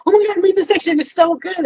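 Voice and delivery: mock gushing excitement; giddy praise. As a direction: gush back at him with a lifted voice. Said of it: "And I'll be like".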